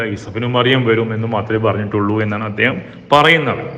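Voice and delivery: usual speaking voice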